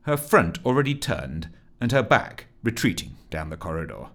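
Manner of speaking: usual speaking voice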